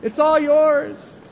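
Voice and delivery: a cheerful giver cheering as he gives it all; hilarious giver. Retold or imitated. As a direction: throw your money at God with a whoop. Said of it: "Hilarious giver".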